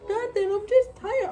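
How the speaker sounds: mock tired brush-off. Mimicking friend's flat denial.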